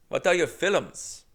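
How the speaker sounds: put-on slow, puzzled Indian-accented repeat; Indian accent impression. Comic echoing the clerk's question in Indian accent. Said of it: "unfortunately, keep doing it".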